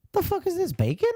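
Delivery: baffled question. Retold or imitated.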